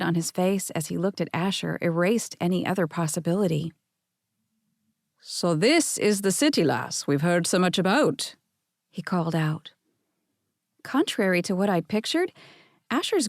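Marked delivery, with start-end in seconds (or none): 5.2-8.3 lightly done Scottish grandfather calling out; a hint of a Scottish brogue, hearty welcome; mild Scottish burr; Scottish accent. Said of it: "He sounded like he was right off a plane from Scotland".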